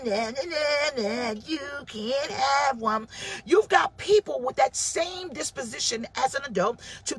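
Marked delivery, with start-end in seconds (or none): 0.0-3.1 put-on slow teasing kid voice, dragging out the nahs; taunting little girl. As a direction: tease like a child hoarding her chips. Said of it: "she used to go".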